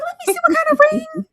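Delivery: high, fast eager squeal; excited squeal. Retold or imitated. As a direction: shriek it with glee. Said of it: "I said".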